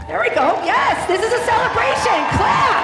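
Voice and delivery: high, loud hype shout; rally the crowd to clap. Real, not acted.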